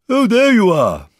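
high, drawn-out loud greeting; calling down. A narrator's reading. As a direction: hail a friend below. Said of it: "called down Winnie the Pooh".

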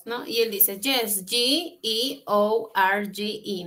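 ordinary talk, nothing marked

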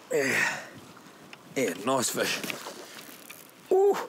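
ordinary delivery

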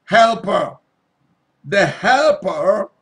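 "helper" hit loud and slow; preacher hammering the word "helper"; stress on "helper". Real, not acted. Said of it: "underline the word".

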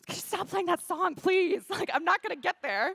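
loud, high-pitched exhausted protest; re-enacted desperate outburst; exasperated plea. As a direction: beg them loudly, at your wits' end. Exhausted, begging the music to stop.

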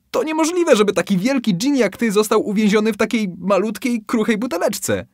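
high, loud mock-doubtful taunt; feigned disbelief. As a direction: bait him with exaggerated doubt. Fisherman tricks the genie.

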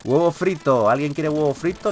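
ordinary talk

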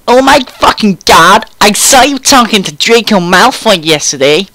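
high, loud gushing excitement; excited. A narrator's reading. Friend teasing about seeing Draco, excitedly. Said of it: "she said excitedly".

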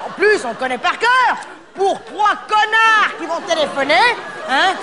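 rising pitch, slowing into a high rant; getting worked up. Real, not acted. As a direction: let the irritation climb high and slow. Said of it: "je suis énervé".

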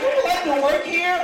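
high, bright-eyed barista; mock eager barista asking. Barista voiced.

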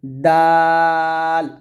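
দাল held long; drawn-out dal. Shown as a demonstration. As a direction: stretch দাল. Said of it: "তারপর হচ্ছে".

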